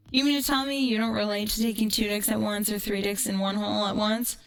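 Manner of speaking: usual speaking voice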